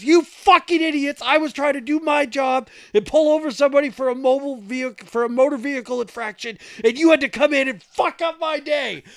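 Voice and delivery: sustained high yelled tirade; trooper losing it. Retold or imitated. Trooper berates drivers who interrupted his stop, screaming mad. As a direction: bellow it all as an imitated cop at breaking point. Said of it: "Fucking loses it".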